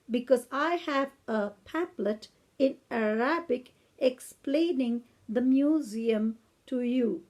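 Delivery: slow, carefully spelled-out British English; elderly British lady. Retold or imitated. Old lady offers a pamphlet in Arabic, slow British English imitation. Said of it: "I'm gonna imitate her now".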